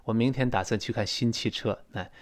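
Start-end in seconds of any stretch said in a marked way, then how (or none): none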